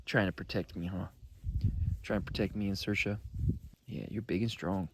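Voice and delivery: quiet, gentle pet talk; soft and tender. Real, not acted. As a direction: murmur to the dog lovingly. Talking softly to dog.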